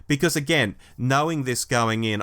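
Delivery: normal talking voice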